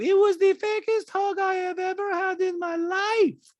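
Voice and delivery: high, loud, drawn-out dramatics; Diana. Retold or imitated. Diana cutting Sutton off, dramatic high impression. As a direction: cut in way up high, hamming it up. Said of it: "Don goes".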